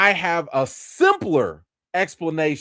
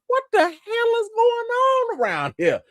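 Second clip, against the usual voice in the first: very high, squeaky bewildered clown; bewildered Pennywise. Retold or imitated. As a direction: squeal it like a baffled cartoon clown. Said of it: "And Pennywise like".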